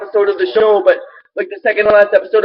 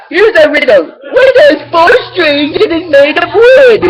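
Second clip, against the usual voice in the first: Puppetmon riddle; 8 semitones higher, 4 dB louder than usual. Retold or imitated.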